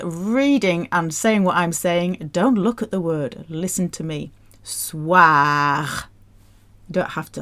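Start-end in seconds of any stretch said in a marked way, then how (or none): 4.6-5.9 "Soir" drawn out with a heavy throaty R; long "swah" ending in an exaggerated guttural R; exaggerated French R. Shown as a demonstration. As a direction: overdo the rasped R ending "Soir". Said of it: "I'm exaggerating it".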